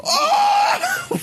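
high startled laugh. Real, not acted. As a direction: yelp then laugh. Startled by watch poke.

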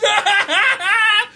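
a big real laugh right after the porg yell. Real, not acted. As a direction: crack up hard. Laughing at his own porg outburst.

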